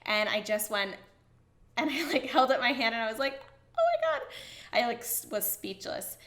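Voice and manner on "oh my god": very high excited squeal; stunned excitement. Retold or imitated. Showing her brother the engagement ring, high excited squeal. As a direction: squeak it out in shock and joy.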